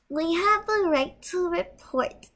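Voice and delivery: high whiny mock of kids claiming their rights; mocking bratty kids. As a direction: parody the brats with a sneer.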